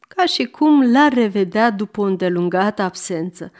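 ordinary delivery

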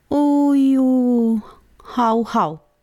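jovial dog yelps; dog barking. A narrator's reading. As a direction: yelp like an eager hungry pointer. Dog Ali barking at table.

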